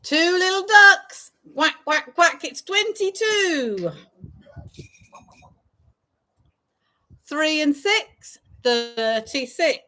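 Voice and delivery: sing-song bingo number calls; drawn-out call then quick repeat; bingo caller. Acted.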